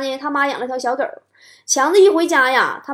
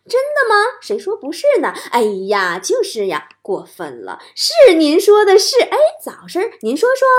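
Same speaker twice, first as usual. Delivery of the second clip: crosstalk straight man; 6 semitones higher, 5 dB louder than usual. Shown as a demonstration. Chatting with elders as straight man, hammed-up interest. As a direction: play the eager crosstalk sidekick egging on. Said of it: "我再你们重复一遍啊".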